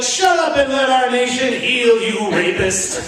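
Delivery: high, indignant mock scolding; mock-righteous scolding. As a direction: scold the victim in a self-righteous way. Mocking the opponent's view.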